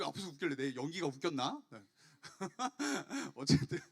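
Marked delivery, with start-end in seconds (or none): 2.2-3.3 short burst of amused laughter. Real, not acted. Preacher amused at giggles from the front row, laughing. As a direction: laugh it off, breaking character.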